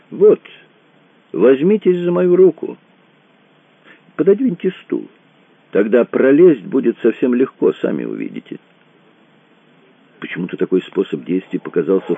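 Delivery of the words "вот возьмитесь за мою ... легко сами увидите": high-pitched, instructing companion; high voice, dropping at the end; helpful mysterious companion. A narrator's reading. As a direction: guide him through in a raised voice. Companion coaxes Randall through mirror.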